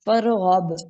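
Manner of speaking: फरगब recited with a hard final stop; reciting the word. Shown as a demonstration. Teacher reciting the word for students, stopping on फरगब. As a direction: say फरगब clipped short on the last letter. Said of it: "को फरगब ही पढ़ देंगे".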